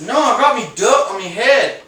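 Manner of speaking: loud, high mock British insistence; British accent. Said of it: "And he was British".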